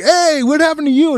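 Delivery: high, excited call to a lost friend; shouting over in disbelief; surprised greeting. Retold or imitated. Kids spotting their missing friend, high surprised call.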